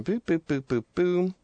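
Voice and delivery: boop to yourself while searching; absent-minded run of light, high boops; idle booping. Real, not acted. Filler noises while looking something up.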